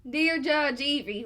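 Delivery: slow, loud Kentucky drawl; Kentucky accent. Acted. Co-host reading a listener's letter as requested, Kentucky accent. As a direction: draw out the greeting in a put-on Kentucky accent. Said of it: "second part in the accent".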